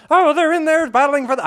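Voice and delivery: high, loud excited play-by-play call; Bob Cole impression. Host imitating Bob Cole's excited playoff call. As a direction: call it like an old-school playoff announcer. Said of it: "I can't do his accent".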